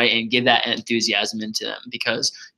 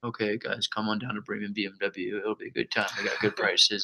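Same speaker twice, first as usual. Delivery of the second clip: mock ad read, much softer, flat and unexcited; bored radio ad; 2 semitones lower, 10 dB quieter than usual. Shown as a demonstration. Mocking a radio ad with no enthusiasm, bored. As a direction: read the ad like you couldn't care less. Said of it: "you don't hear 'em like".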